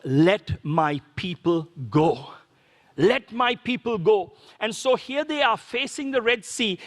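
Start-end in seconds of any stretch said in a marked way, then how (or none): 0.0-2.3 solemn proclamation. Retold or imitated. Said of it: "the one-liner was".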